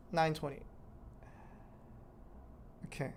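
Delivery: normal talking voice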